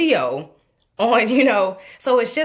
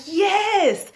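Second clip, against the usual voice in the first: much higher, exultant yes; triumphant yes; 12 semitones higher than usual. Retold or imitated. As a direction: cheer the moment. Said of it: "we had that moment like".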